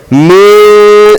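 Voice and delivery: mi- held long in a nasal hum; noon hidden. Shown as a demonstration. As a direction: hum mi- without closing on the n. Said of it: "emphasis towards the sheen".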